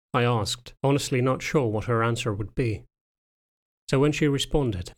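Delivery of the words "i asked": ordinary delivery, nothing marked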